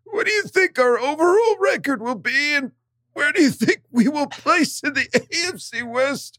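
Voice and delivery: put-on pained, high old man voice; strained like you've been kicked; just hit in the groin. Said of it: "an old man that got kicked in the nuts".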